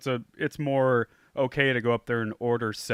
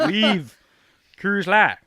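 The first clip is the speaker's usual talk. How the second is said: put-on Southern accent; 8 semitones higher, 9 dB louder, much slower than usual. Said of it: "really thick Southern accent".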